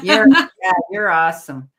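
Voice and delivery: laughing through the praise. Real, not acted. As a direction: giggle as you say it. Laughs while praising viewer.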